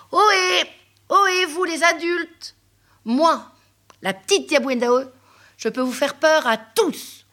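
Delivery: cri aigu et fort de fillette; la petite crie. Acted. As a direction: crie du haut des cageots pour défier. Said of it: "elle crie". (la fillette crie aux adultes, aigu et fort)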